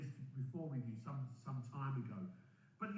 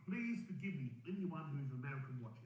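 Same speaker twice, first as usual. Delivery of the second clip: ordinary delivery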